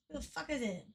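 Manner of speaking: ordinary talk, nothing marked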